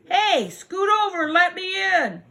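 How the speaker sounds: high, pushy little mouse; insistent mouse. Acted. Mouse to the packed animals, high and insistent. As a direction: call out high as a demanding mouse.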